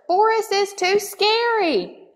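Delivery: high, squeaky mouse chorus; squeaking together. Acted. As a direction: squeak it as all the mice at once. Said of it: "they all squeaked together".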